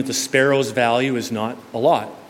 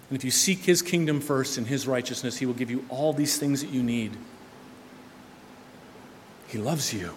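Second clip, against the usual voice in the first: softened; 8 dB quieter than usual. Acted. Preacher softens after exhortation.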